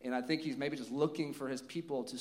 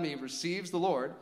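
usual speaking voice

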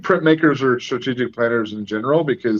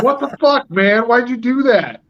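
much higher-pitched, exasperated parent; mock exasperated scolding; 14 semitones higher, 4 dB louder than usual. Parent talking to my son, mock exasperated scolding. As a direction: retell a telling-off in a high, incredulous voice.

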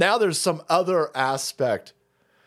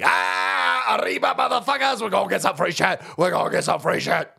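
higher mock Spanish accent, shouting first; a rowdy hammed-up crowd; mock Honduran; 3 semitones higher than usual. Hondurans in the camp, in a shouted mock Spanish accent. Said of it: "the Hondurans are like".

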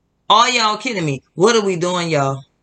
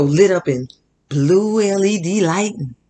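ordinary talk

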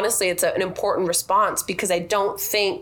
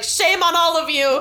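higher and louder, scolding the whole table; 8 semitones higher, 4 dB louder than usual. Retold or imitated. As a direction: call it out to everyone, shaming them.

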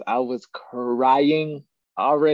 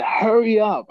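higher, louder hurried plea; urgent plea; 9 semitones higher, 5 dB louder than usual. Retold or imitated. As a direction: beg him to move, short of breath. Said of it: "and I said".

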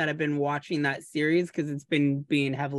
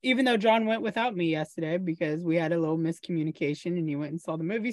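usual speaking voice

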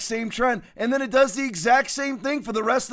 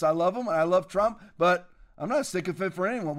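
ordinary talk, nothing marked

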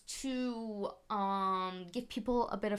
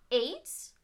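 mock gasp; 10 semitones higher than usual. Gasped dramatic reveal.

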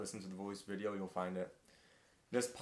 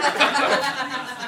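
a high, loud real laugh; hearty burst of laughter; 12 dB louder than usual. Real, not acted. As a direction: crack up out loud. Host cracks up.

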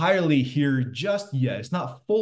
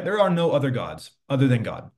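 usual speaking voice

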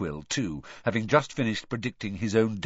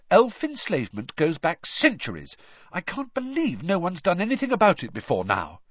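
higher-pitched, earnest crusading; indignant lecture; 8 semitones higher than usual. A narrator's reading. Hermione defending her manifesto to friends, indignant. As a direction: argue the cause with heat.

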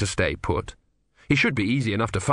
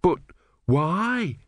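high, pleading why; anxious question; 8 semitones higher than usual. Acted. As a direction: beg for a reason. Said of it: "wailed Brother".

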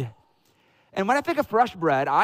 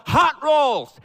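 loud, higher-pitched vendor's call; imitated yell; 6 semitones higher, 5 dB louder than usual. Imitating the dude with the roll cart, yelling out. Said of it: "and he yells out".

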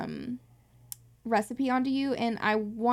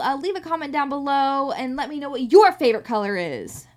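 hammed-up YouTuber outro; 2 semitones higher, 7 dB louder than usual. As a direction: hype it like a YouTube host. Mock YouTuber outro call.

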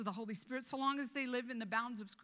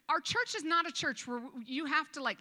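ordinary talk, nothing marked

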